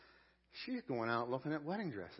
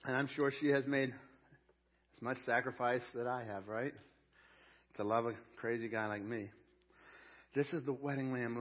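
ordinary delivery

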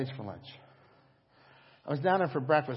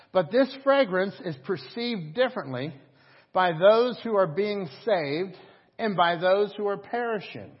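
raised preaching voice, slow stress; 8 semitones higher, 9 dB louder, slower than usual. Acted. Pastor preaching to the church, raised and emphatic. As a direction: preach it out to the congregation.

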